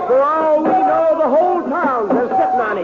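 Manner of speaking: high, excited calling out to the crowd; excited shout. Acted. Doc telling the courtroom Annie found silver, excited high call. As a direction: rouse the whole room with the news.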